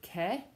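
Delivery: che said "keh" with a K; hard C. Shown as a demonstration. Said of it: "that C becomes hard".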